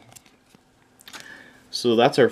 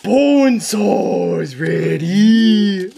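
slow, higher hammed-up announcer growl; Bonesaw wrestler voice; 7 semitones higher, 4 dB louder, much slower than usual. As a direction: drawl it like a pro wrestler. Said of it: "That sounds like a Bonesaw from Spider-Man".

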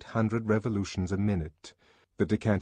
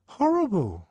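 put-on high, loud boyish exclamation; high-pitched, heartfelt answer; schoolboy's dismay; 13 semitones higher, 5 dB louder than usual. A narrator's reading. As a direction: blurt out the awful memory. Boy recalls the emotion.

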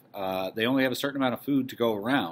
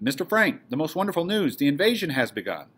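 higher-pitched, eager announcement; excited; 5 semitones higher, 3 dB louder than usual. Acted. Meep bringing invasion news to Mister Frank, excited. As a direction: burst in with wonderful news. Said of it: "We hear Meep's excited voice".

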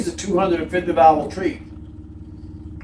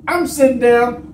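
usual speaking voice

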